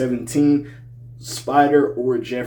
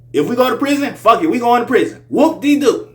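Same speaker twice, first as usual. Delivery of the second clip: very high, loud hyped-up holler; exuberant title shout; 12 semitones higher, 9 dB louder than usual. Shown as a demonstration. Reviewer hyping a track title for viewers, high exuberant shout. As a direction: belt it out high, WHOOP-DE-DOO falling at the end. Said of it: "I'll say it like that".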